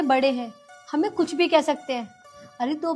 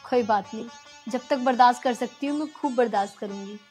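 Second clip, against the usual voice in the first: soft pleading; 3 semitones lower, 5 dB quieter than usual. Acted. Wife calms husband softly.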